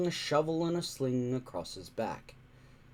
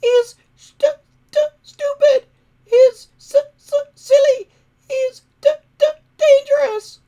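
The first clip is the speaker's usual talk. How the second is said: high, stammering dragon voice near tears; squeaky stuttering dragon on the verge of crying; whiny little dragon; 15 dB louder than usual. A narrator's reading. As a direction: whimper it out like a tiny sulky dragon. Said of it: "he complained".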